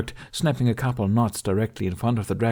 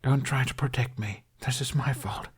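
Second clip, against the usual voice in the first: softer, whispered pleading; broken whisper; 4 semitones higher, 3 dB quieter than usual. A narrator's reading. Hiccup after the crash begging Toothless, hushed whisper. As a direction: beg him in a trembling hush.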